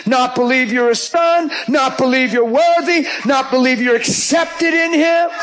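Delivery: full-force preacher cadence; fiery preaching. Acted. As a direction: preach it hard to the crowd.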